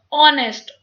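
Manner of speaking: "ऑनेस्ट" begun straight on the o vowel; silent H. Shown as a demonstration. Teacher repeating the example word, "ऑनेस्ट" starting on o. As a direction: say "ऑनेस्ट" with no h at the front. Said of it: "हम इसको ओ से ही start करते हैं".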